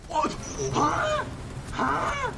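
high loud startled huh; shocked huh. Real, not acted. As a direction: yelp in disbelief. Shocked in game.

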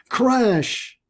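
sudden high-pitched exclamation; exclaimed crash. A narrator's reading. Mister Bird bumps into something in the dark, sudden exclaimed crash. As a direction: let the word burst out high.

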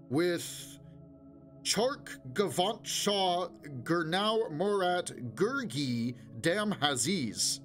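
amplified announcer mangling name. A narrator's reading. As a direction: drag out each foreign syllable. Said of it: "whose amplified voice rang round".

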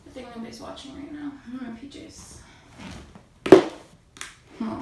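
usual speaking voice

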